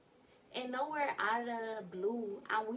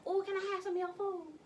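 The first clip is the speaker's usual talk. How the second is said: high, light mock voice asking politely; sweet request; 9 semitones higher than usual. Mom asking daughter for takeout, high polite voice. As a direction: play her asking sweetly for food. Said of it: "She was like".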